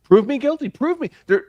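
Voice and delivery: high, loud mock defiance; defiant challenge. Voicing defiant defendant.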